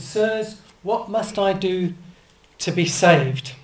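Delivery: frightened jailer begging for rescue; desperate jailer's plea. Acted. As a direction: beg to be saved, voice climbing in volume. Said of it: "he came out and said".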